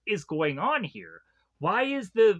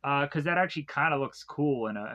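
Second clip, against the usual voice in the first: ordinary talk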